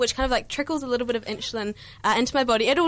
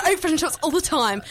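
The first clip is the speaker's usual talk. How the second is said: higher, hammed-up Kiwi accent; exaggerated New Zealand boast; amped-up New Zealand accent; 4 semitones higher than usual. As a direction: thicken every New Zealand vowel. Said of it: "I amp up my New Zealand accent".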